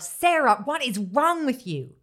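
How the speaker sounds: imitating a mother-in-law yelling; a mild mock yell of outrage; retold yell. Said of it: "My mother-in-law yelled".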